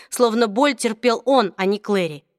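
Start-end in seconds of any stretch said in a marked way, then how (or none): none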